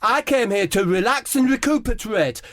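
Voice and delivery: high, prim, mournful Harry Kane voice; Harry Kane fussing at the kiosk about his Sprite, voiced high and plaintive; Harry Kane impression. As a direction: whine it politely like a sulky footballer. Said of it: "It's Harry Kane".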